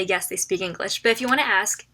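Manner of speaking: usual speaking voice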